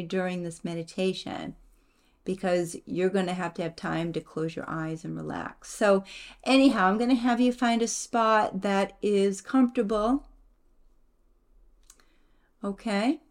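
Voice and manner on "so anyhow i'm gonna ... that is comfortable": usual speaking voice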